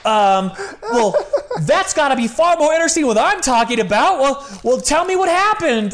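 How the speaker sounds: high, flustered and laughing. Retold or imitated. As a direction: backpedal nervously with a laugh. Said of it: "And he's like".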